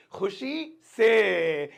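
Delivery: "खुशी से" very slow, long final word; drawn-out repetition. Shown as a demonstration. Teacher telling students what happily means, drawn out. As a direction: stretch out "खुशी से" for learners. Teacher repeats meaning slowly.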